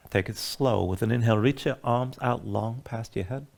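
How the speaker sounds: soft spa whisper. Acted. Guides a relaxation breathing sequence.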